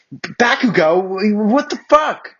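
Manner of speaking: high, loud shocked shout; girl shouting in shock at Bakugo. Acted. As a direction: yell it in outrage at him. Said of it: "I shouted".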